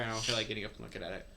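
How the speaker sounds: fast, low, lazy mumble. Real, not acted. [name] mumbles that he won't get up for his copy, fast. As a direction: rushes through the excuse. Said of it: "lazy aside".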